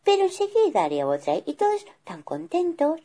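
high, gentle calming wife; mother bear soothing her angry husband; tender, high reassurance; kind mother bear. Acted. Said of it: "que era muy buena".

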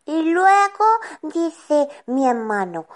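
usual speaking voice

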